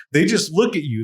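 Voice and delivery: normal talking voice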